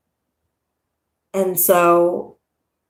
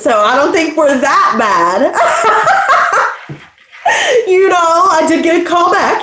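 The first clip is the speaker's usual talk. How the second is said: laughing; 10 semitones higher than usual. Real, not acted. Laughing at herself.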